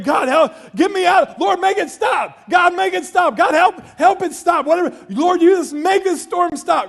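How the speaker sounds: high, shouted pleading to God; desperate prayer. Retold or imitated. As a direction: reenact frantic praying through the tornado. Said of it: "I'm praying".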